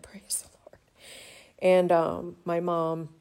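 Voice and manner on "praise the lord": soft whispered praise; whispering. Real, not acted. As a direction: breathe it out as a whisper. Grateful aside after laughing.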